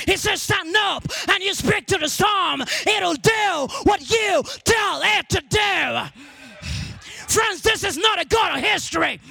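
high, driving sermon delivery; impassioned preacher rousing the church; full-force preaching. Real, not acted.

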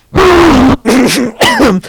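cranked high like a toy. Pretend. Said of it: "Okay, hold on".

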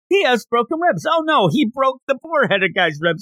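high, frantic squeal of alarm; mock panic. Zenitsu's panicked play-by-play voiced.

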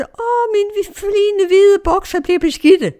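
put-on very high, loud, drawn-out child's complaint; little girl wailing. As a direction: wail slowly like an upset small girl, imitated. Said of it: "og den lille pige råbte".

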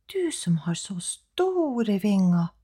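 flattering whisper. Acted. Elle whispers to coax the crow, whispering.

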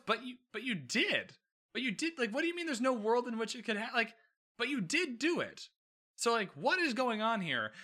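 as if catching her in a lie; mock outraged disbelief at Kate; incredulous disbelief. Reading his own incredulous notes.

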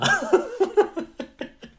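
sudden high-pitched laugh; real amused laugh. Real, not acted. Laughs at own joke.

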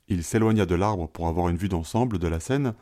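plain reading voice

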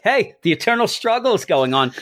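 retold yell. Telling how he alerted Eric to the struggle, mock yell. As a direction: lightly imitate calling over to a coworker. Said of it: "I just yelled at Eric".

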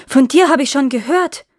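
high, loud startled cry; frightened cry. Acted. Janet recognizes the fairy knight, frightened loud cry. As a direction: blurt it out in sudden fright. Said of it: "rief der Janet erschrocken".

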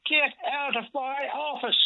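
high, slow, outraged order; ordering him out. Retold or imitated. George ordering Roger out of his office, outraged. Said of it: "He said".

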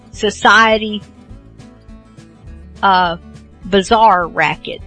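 usual speaking voice

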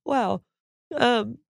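choked up but trying to laugh it off; tearful. Real, not acted. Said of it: "I'm gonna cry now".

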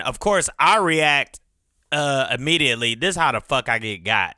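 normal talking voice

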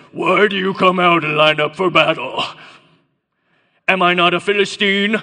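loud giant's challenge in a put-on accent; Goliath's accented bellow. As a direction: boom it out like an enemy champion. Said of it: "it was more like this".

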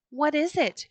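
high, eager cry of the men; a bright startled shout; excited cry. A narrator's reading. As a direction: call out in sudden excitement. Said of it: "cried the men".

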